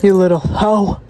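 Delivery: usual speaking voice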